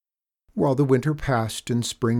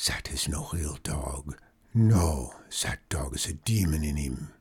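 put-on lower, hushed French-accented whisper; 7 semitones lower, 3 dB quieter than usual. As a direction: whisper it like a dark secret. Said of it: "his voice dropped to a whisper".

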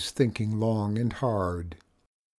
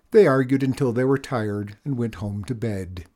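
usual speaking voice

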